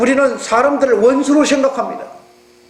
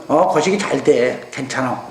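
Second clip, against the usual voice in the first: 전라도 사투리로 낮고 느리게; Jeolla dialect reply; 8 semitones lower, 3 dB quieter than usual. Retold or imitated. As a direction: answer easily in Jeolla dialect, trailing off. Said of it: "전라도 사람들이 가서 그러지".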